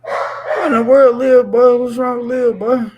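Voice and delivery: loud, high what-in-the-world cry; stunned shout at the screen; shocked outburst. Real, not acted. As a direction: react in loud disbelief.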